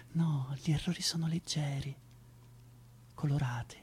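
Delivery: soft whispered aside. Acted. Actor reassures Zoe, whispering. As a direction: let it float out on breath.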